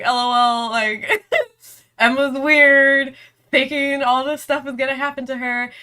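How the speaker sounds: a light mock of teasing classmates. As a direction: tease her like the laughing class. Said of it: "everyone starts, like, laughing at me".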